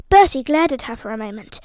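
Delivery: ordinary delivery, nothing marked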